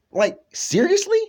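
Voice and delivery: high, disbelieving seriously; retold disbelieving seriously; incredulous. Incredulous line in a retold quote.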